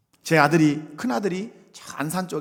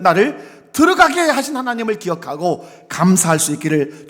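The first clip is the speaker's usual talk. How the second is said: loud, rising sermon delivery; impassioned preaching; 2 semitones higher, 10 dB louder than usual. Acted. Pastor closing his sermon to the faithful, loud and fervent. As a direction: preach with full conviction.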